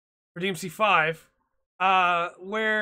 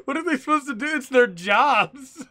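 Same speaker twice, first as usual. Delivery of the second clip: exasperated protest; 5 semitones higher, 5 dB louder than usual. Real, not acted. Comic exasperation for the interviewers.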